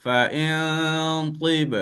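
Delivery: Fa in tib very slow with a thick hum on n; thick slow reading. Shown as a demonstration. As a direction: stretch Fa in tib heavily. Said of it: "huruf-huruf yang tebal". (bacaan tebal perlahan)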